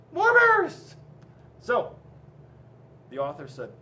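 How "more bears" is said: put-on high, loud kids' shout; high-pitched holler of boys and girls; children yelling. A narrator's reading. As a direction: yell it like an eager crowd of kids. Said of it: "the boys and girls said".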